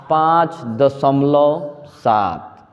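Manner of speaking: धीरे-धीरे, हर शब्द अलग करके पढ़ना; समझाते हुए धीमे पढ़ो; slow model reading. Shown as a demonstration. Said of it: "तो क्या पढ़ते?". (धीमी, साफ़ पढ़ाई)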